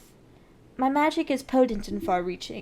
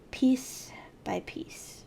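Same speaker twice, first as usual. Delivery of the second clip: whisper; much slower than usual. Acted. Relishing rival's downfall.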